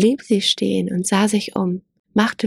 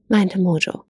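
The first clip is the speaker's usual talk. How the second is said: ordinary delivery, nothing marked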